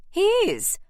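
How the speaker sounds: high, loud great lady; triumphant aunt. A narrator's reading. Aunt announcing the family invitation, triumphant. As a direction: announce it grandly, pitched high.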